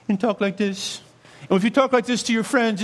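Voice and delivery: a hint of cooing at a small child; baby talk. Shown as a demonstration. Parent to a small child, mild baby talk. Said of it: "baby talk you".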